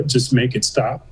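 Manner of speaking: ordinary talk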